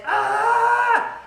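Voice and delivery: high, loud "Ah!" scream; ordinary scream. Shown as a demonstration. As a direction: give a straight high-pitched scream. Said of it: "my screaming wasn't".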